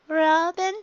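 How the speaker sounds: high, slow, dopey giggling; loopy under sedation, giggly and high; high sing-song drugged voice; drugged giggly Luffy. Acted.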